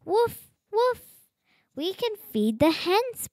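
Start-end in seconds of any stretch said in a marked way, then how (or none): 0.0-1.0 high, bright little yips of a puppy; puppy woof. Acted. Reading a picture book aloud, puppy Biscuit barks with high yips. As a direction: yap it like a happy puppy.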